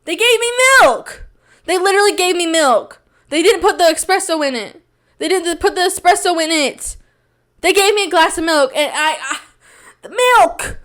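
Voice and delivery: high-pitched, hammed-up indignation; incredulous outrage. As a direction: wail in disbelief at the injustice. Acted outrage at getting milk.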